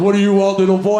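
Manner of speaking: put-on Santa rumbling down at a small boy; deep Santa voice. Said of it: "Santa's going".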